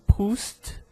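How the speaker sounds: "Proust" closing on an audible st; T final prononcé. Shown as a demonstration. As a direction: sound the t at the end of "Proust". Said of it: "eu pronuncio o T".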